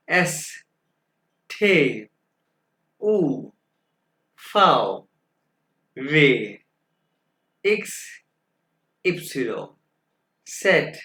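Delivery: each letter called out singly, with long pauses; German alphabet demonstration. Shown as a demonstration. Teacher reads alphabet for students to follow, emphatic spaced-out letters. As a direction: model each letter name firmly, drawn out, one at a time. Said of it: "दोबारा pronounce करके बताऊंगा".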